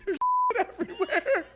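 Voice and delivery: high shrieking words breaking into laughter; high laughing yelp about the mess. Retold or imitated. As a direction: squeal it out while cracking up. Retold panic in McDonald's bathroom.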